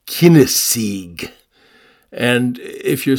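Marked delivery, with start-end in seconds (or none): 0.1-1.3 Kinnesig said slowly, KIN-neh-sig with a hard final g; Old English pronunciation. Shown as a demonstration. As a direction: pronounce Kinnesig the old Anglo-Saxon way, slow and careful. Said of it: "it would have been pronounced perhaps something like".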